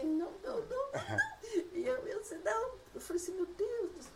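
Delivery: very high, whimpering retold refusal; mock crying protest. Woman retelling her tearful refusal of God's choice, mock crying. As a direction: wail it in a squeaky, hammed-up cry. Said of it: "Chorei, eu falei".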